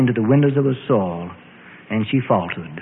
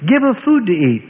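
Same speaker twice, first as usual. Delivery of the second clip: instructing; 7 semitones higher, 4 dB louder than usual. Acted. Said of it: "Jesus instructed".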